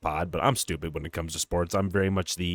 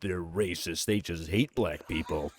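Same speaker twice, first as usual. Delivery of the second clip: slow, softer accusing listener voice; imagined critics; 3 semitones lower, 4 dB quieter, slower than usual. Retold or imitated. Imitating listeners judging the two hosts, slow accusation. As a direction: voice a critic's verdict, slowly and quietly. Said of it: "people hear this, they're gonna go".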